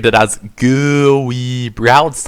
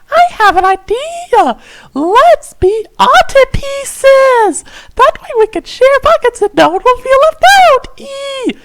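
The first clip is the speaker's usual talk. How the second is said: squeaky fairy; 5 dB louder than usual. Acted. Fairy pitching her octopus idea to both boys, squeaky high. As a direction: gush like a giddy tiny fairy.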